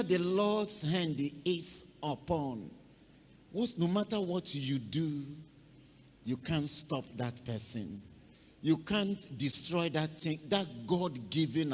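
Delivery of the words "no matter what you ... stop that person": ordinary talk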